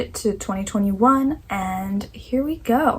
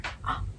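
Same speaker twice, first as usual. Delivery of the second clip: quick, soft gasp of realisation; sudden gasp; 14 dB quieter than usual. Real, not acted. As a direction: catch your breath as you suddenly remember. Suddenly remembers a forgotten audiobook.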